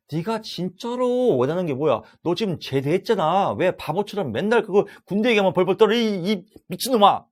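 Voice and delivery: loud, high-pitched scolding; acted confrontation. Counselor confronting a trembling army veteran, mock angry scolding. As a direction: berate them with building exasperation, dragging out the final insult. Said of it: "이렇게 막 얘기하는 거죠".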